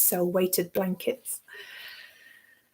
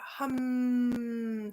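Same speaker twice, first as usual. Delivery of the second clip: soft, drawn-out hum on "hum"; demonstrated hum; 7 dB quieter than usual. Shown as a demonstration. Teacher showing listeners the wake-up signal, softly hummed "hum". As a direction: stretch "hum" into a long, quiet humming sound. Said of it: "make three hums".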